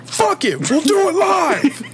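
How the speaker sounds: high, laughing mock tirade; Bill O'Reilly. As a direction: shout it like a hammy TV host. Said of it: "like Bill O'Reilly here".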